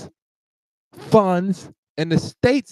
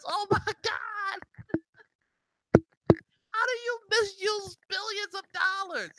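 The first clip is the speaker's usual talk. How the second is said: much higher, softer, incredulous gasp; can't believe the billions; stunned disbelief; 14 semitones higher, 7 dB quieter than usual. Real, not acted. Disbelief over wasted billions.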